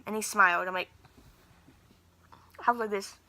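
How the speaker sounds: ordinary delivery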